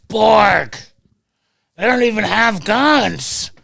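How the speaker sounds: high, loud, hurried heads-up; urgent friend. Retold or imitated. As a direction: tip him off loudly that the cops aren't armed. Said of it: "And Rayberg's like".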